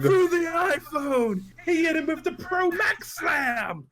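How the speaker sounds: high, slow-stretched commentary yell; wrestling commentator. Acted. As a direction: call the slam in mock disbelief, drawing it out. Mock wrestling commentary of hitting customer.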